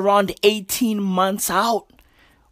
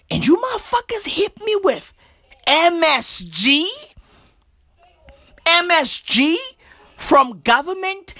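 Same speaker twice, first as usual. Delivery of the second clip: indignant; 9 semitones higher than usual. Real, not acted. Outraged rant about the abbreviation.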